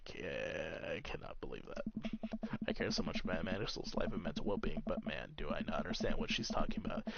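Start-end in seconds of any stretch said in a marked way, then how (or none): 0.0-2.6 soft disbelief. Acted. Repeats disbelief line, softer.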